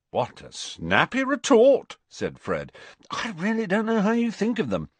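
mock-admire him; sarcastic. Fred's mock praise.

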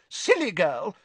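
high, brisk schoolmistress; bossy teacher, pitched high. A narrator's reading. Voices Professor Sprout.

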